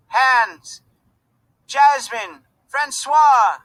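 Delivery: high, loud calling out over a crowd; screaming names. A narrator's reading. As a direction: yell the names across the wharf. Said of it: "Voices screamed out names".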